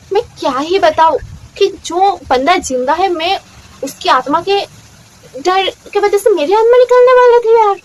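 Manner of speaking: half laughing, half exasperated; excited outburst. Real, not acted. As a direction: build up till it bursts out loud. Recalling laughing and fright.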